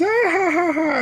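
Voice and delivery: mocking laughter. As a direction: laugh him off the field. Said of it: "they laughed him off the field".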